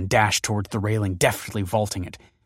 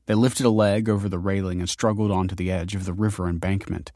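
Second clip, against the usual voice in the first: usual speaking voice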